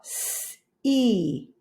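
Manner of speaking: S-E split into separate sounds; S-E sounded out slowly, sound by sound. Shown as a demonstration. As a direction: tap out S-E one sound at a time. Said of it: "Tap it out".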